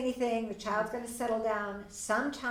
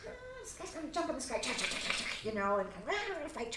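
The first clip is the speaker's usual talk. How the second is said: fast, higher excited play sounds; playful kid-game voice; 4 semitones higher, 4 dB quieter, much faster than usual. Shown as a demonstration. Parent joining child's toy play, fast excited play-acting. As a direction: ham it up like toys fighting. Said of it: "then you start going".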